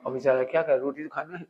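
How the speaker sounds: ordinary talk, nothing marked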